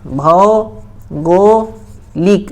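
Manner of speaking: भौगोलिक stretched very slowly, vowel by vowel; slow drawn-out spelling. Shown as a demonstration. Teacher drawing out भौगोलिक for the class. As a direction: drag out भौगोलिक, rising at the end. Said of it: "हम कैसे लिखेंगे उसको नागपुरी में".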